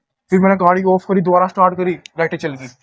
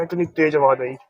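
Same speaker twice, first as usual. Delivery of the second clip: ordinary talk, nothing marked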